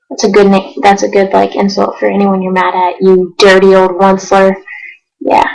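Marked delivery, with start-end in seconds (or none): none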